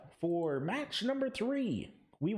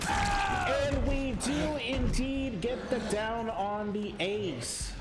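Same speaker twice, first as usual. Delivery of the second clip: drawn-out, higher hype call; excited call; 5 semitones higher, much slower than usual. Real, not acted. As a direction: stretch it out like a big play call. Casting a big down.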